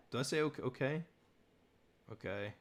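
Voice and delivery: ordinary talk, nothing marked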